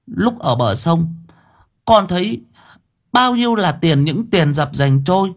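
put-on a boy reliving a near drowning; young boy telling. A narrator's reading. Boy tells his story.

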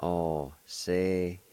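Alcehiste drawn out, syllables evenly spaced; measured teaching pronunciation; slow syllable demo. Shown as a demonstration. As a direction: say it slowly, part by part. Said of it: "It's pronounced like this".

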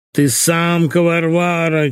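high, droning whine; drawn-out whining. A narrator's reading. Husband insults his wife in a drawn-out whine. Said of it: "тягуче заныл он".

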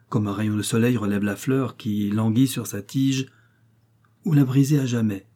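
plain reading voice

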